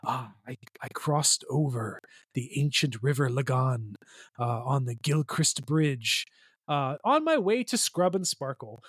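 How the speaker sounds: slow mock Irish accent, storyteller tone; playful Irish accent, like an old yarn. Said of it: "I'm not gonna do a shitty Irish accent".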